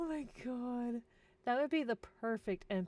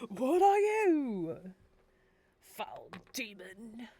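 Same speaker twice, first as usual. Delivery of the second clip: hammed-up accuser; 2 semitones higher, 11 dB louder, much slower than usual. Villager confronting a foul demon, loud hammed-up slow accusation. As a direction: cry out the challenge theatrically, then slow right down. Louder retake of acted dialogue.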